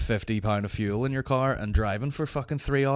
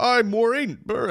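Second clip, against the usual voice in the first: high, louder mocking "Aye, Maureen."; heckler; 13 semitones higher, 5 dB louder than usual. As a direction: jeer like a pub heckler. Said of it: "someone else would go".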